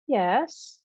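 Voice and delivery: "Yes" lilted up high; drawn-out teasing lilt; sing-songy. A narrator's reading. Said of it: "sing-songy again".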